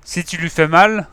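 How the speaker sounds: put-on a boy yelling a threat at the kidnapper; yelling hoarse. A narrator's reading. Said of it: "s'est égosillé Silver".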